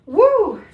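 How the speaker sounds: high, loud whoop; excited whoop. Real, not acted. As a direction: let out a cheer. Exclaiming after pole move.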